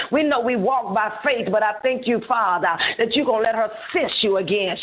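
flowing intercessory prayer, pitch slightly lifted; fervent prayer. Real, not acted. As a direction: pray it over her in rolling, chanted phrases.

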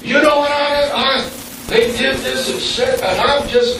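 high, sputtering mock fury at a wrong; stammering, half-acted indignation; inner angry rant. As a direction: mimic your own fuming thoughts, pitched high. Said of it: "I'm like".

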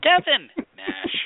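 high, loud KEVIN, drawn-out drop on Nash; exaggerated interviewer inflection on "Kevin Nash"; Pamela Paulshock. Podcaster mocks the interviewer waiting backstage, exaggerated high inflection. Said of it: "I just love the voice inflections".

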